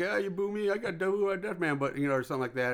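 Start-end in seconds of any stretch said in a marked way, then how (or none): 0.1-1.8 put-on Jamaican-style accent. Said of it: "almost more a thick Jamaican accent".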